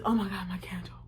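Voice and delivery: breathy whisper of horror; whispered dismay. Retold or imitated. She realizes her candle dropped, a horrified whisper. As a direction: gasp it out under your breath.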